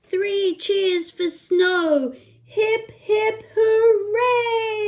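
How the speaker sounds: high, loud cheer. Acted. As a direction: cheer it like a celebration. Cheering ending of book.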